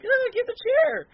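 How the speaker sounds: very high, slow squeak; imitating a wrestler in a silly high voice; mock squeaky voice. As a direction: squeak it out like a tiny voice. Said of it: "he, like, legitimately talks like that".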